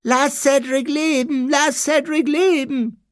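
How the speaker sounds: very high whiny taunting voice; mock him in a high, pointed whine; squeaky mimicked wailing; mocking whimper. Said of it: "nahm eine spitze, wimmernde Stimme an".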